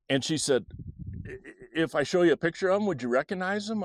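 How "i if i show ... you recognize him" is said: quick, high, eager questioning; worked-up mom. Retold or imitated. Mom offering her child a photo, worked up. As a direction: imitate a mother hurrying out her question. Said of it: "Mom was definitely getting worked up".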